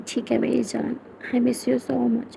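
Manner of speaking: soft and very slow, lingering on each word; tender goodbye. Acted. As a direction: say it softly like missing someone dearly. Lovers saying goodbye on call. (धीमा, कोमल प्यार भरा स्वर)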